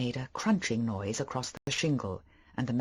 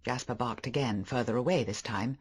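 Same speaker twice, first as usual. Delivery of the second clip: ordinary delivery, nothing marked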